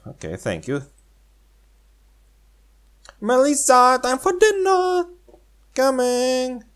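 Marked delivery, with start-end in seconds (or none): 3.2-6.6 very high sing-song call; calling mom voice. Pretend. A mother calling Melissa in for dinner, high. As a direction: call her home like a mother. Mom calls girl, girl answers.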